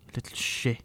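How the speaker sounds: whispered, furious insult under the breath; hissed insult. Acted. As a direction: spit it out in a whisper. Angry whispered insult in quarrel.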